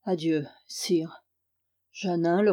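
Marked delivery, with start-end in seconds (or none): 0.0-1.2 soft unvoiced whisper, slowly; a hushed, slow adieu; whispered farewell. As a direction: breathe the goodbye without voice.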